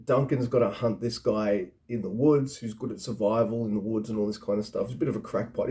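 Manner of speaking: ordinary talk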